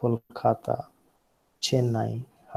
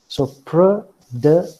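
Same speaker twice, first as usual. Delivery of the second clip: "pro, de" broken apart, each syllable higher and louder; 4 semitones higher, 7 dB louder than usual. Shown as a demonstration. Lecturer counting out syllables for students, "pro, de" pronounced one at a time. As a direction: split "pro, de" into separate, punched syllables. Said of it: "production are the three".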